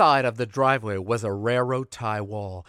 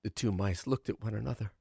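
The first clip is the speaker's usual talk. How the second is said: usual speaking voice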